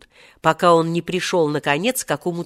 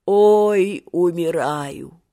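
put-on dying old man; much slower than usual. A narrator's reading. Seryozha rapidly aged and dying, old man's groan. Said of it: "стариковским голосом".

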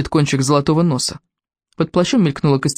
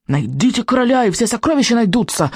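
higher-pitched witch voice; insistent witch; 7 semitones higher than usual. Acted. Witch promising treasures to vikings, insistent. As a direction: press the demand higher, like a witch. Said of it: "гнула своё ведьма".

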